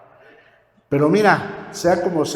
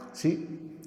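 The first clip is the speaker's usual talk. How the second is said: usual speaking voice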